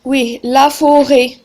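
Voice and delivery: normal talking voice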